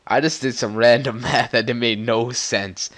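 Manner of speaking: normal talking voice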